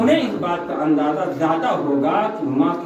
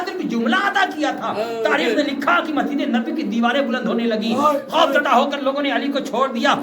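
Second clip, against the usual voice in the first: raised, high-pitched mourning oratory; lamenting preaching; 12 semitones higher than usual. Acted. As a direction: build from urgent telling into a sustained high wail. Reciter preaches at full pitch. (मजलिस में ऊँची, दर्द भरी ख़िताबत)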